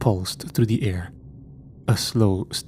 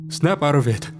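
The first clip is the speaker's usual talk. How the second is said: sharp raised order; louder, stern; 6 semitones higher than usual. A narrator's reading. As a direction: bark it to break the spell. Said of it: "his voice louder this time".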